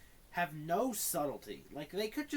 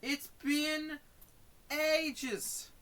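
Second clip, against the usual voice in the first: high, raised-voice protest; exasperated complaint; 7 semitones higher, 4 dB louder, slower than usual. Real, not acted. Streamer griping to viewers about the long mute, exasperated. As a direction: complain high and loud, in short bursts.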